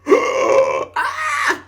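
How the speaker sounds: sudden very high yelp; exaggerated hiccup yelp. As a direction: make the hiccup a big squeal. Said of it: "I'm gonna exaggerate it".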